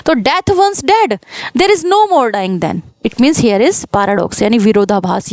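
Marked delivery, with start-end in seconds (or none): 0.2-2.8 reading the poem line very slowly, word by word; slow recitation. Shown as a demonstration. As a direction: dwell on each word of the verse. Teacher quotes sonnet line. (कविता की पंक्ति बहुत धीरे पढ़ना)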